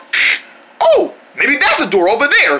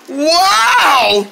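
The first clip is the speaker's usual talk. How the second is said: high, drawn-out whoop; elated high holler; amazed cheer; 6 semitones higher than usual. Acted. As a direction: stretch it out in big amazement. Cheers that all are down.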